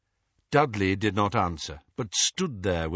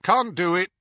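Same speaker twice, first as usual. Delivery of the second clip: terse; 10 semitones higher, 5 dB louder than usual. A narrator's reading. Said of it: "said Hestia tersely".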